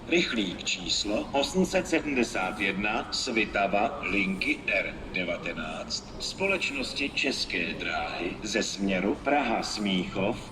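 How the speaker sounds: ordinary talk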